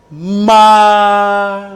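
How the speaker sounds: "Ma" held long, high and level; first tone. Shown as a demonstration. Teacher has class repeat the first tone, high level "Ma". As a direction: sustain "Ma" loudly on one high pitch. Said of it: "le premier ton".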